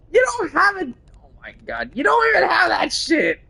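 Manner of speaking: high, loud squeaky yell; high pitched mock shout. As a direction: shriek it up high in mock outrage. Yelling at a game character.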